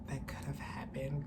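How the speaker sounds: breathy near-whisper, barely voiced. Real, not acted. Shaken vlogger whispering the scary thought. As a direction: let the words fall to a whisper. Emotional aside about danger.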